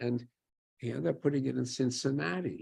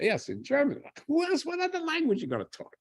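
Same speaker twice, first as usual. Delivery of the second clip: pitched-up mock disbelief at any other language; mock indignant. Teacher imitating German reformers scoffing at other languages, hammed-up. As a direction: lightly play the proud German reformer. Voicing Einhorn's pride in German.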